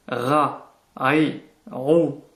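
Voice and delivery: "Ra, ai, ro" strongly marked at the back of the throat; marked guttural R. Shown as a demonstration. Teacher contrasting with the previous letter, marked guttural R. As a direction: dig into the throat for "Ra, ai, ro". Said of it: "qui est beaucoup plus marqué".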